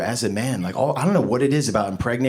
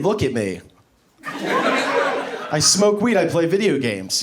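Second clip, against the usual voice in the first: ordinary talk, nothing marked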